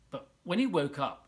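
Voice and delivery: usual speaking voice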